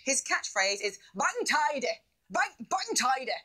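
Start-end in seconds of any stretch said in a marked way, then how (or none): 1.1-3.3 put-on a half-hearted stab at the character's accent; Keith Lemon accent. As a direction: toss off the catchphrase in a light mock accent. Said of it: "Can't do the accent".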